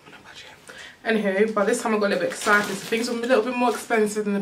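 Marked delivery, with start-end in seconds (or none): 0.1-0.5 hushed, much softer aside; whispered aside. Real, not acted. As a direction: trail off into a whisper. Repeats her excuse as an aside.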